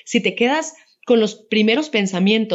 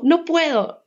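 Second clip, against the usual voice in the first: imitating a tearful little girl, pitched higher; mock-weepy child complaining to mom; whiny crying child; 8 semitones higher, slower than usual. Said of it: "llega llorando y me dice". (niña llorona imitada, voz más aguda)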